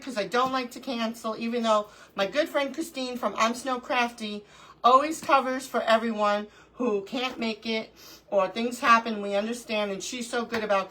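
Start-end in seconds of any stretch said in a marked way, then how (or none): none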